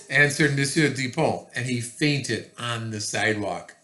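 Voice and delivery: usual speaking voice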